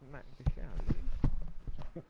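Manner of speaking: loud mock threat. Real, not acted.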